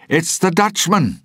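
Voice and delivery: high, loud alarmed shout from the mast; a lookout crying out in alarm. A narrator's reading. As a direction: yell the warning down to the deck. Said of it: "he shouted".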